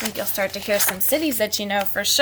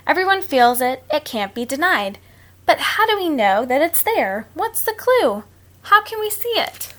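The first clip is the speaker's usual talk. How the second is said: reading a rhyme; 3 semitones higher, 3 dB louder than usual. A narrator's reading. Reads rhyming book aloud.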